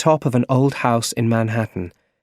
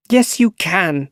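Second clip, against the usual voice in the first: a firm, raised insistence; angry; 9 semitones higher, 3 dB louder, slower than usual. Acted. Aunt insisting nephew take her birthday money, firmly angry. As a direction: insist hard on yes. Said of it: "she said angrily".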